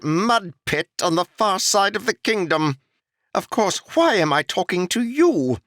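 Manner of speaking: high, haughty, put-upon nobleman's voice; a pampered lord griping about his posting; annoyed noble. Acted. Noble complaining to the old foreman, high annoyed haughty voice. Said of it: "complaining in an annoyed voice".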